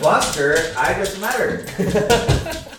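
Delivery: high, slow silly dog voice. Pretend. Voicing the dog.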